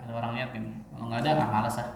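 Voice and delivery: low, soft, can't-be-bothered mumble; mock reluctance. Preacher imitating a worshipper nobody is watching, mock lazy. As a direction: lightly play someone who can't be bothered.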